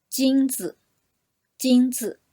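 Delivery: 金子 with 子 short and light; neutral tone demo. Shown as a demonstration. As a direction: say 金子 with a clipped, weightless 子. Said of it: "the second syllable, 子， 子, is very short and light".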